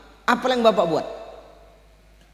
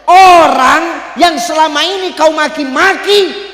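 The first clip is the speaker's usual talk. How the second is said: slow, much louder and higher shout; shouted reveal; 6 semitones higher, 9 dB louder, slower than usual. Acted. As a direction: shout the truth slowly. (teriakan lambat dan keras)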